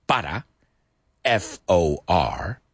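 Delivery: ordinary talk, nothing marked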